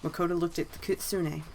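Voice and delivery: ordinary delivery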